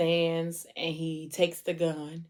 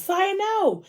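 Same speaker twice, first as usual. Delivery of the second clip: high-pitched drawn cry, moderate volume; mock scream. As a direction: wail it high as if waking in alarm. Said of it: "she wakes up and screams".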